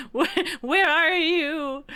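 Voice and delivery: high, loud mock call into nowhere. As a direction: search for an unseen friend. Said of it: "We're like".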